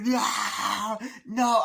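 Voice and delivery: high, loud wail; acted shriek of horror; imitated children screaming. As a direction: cry out like a distraught child. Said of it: "the children are there like".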